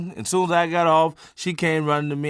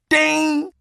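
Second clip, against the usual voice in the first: bell ding; 12 semitones higher, 5 dB louder than usual. Retold or imitated. Imitated boxing bell.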